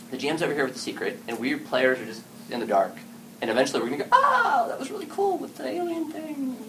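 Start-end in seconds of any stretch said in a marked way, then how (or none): 4.1-6.6 mock late surprise. Player realizing ten games late.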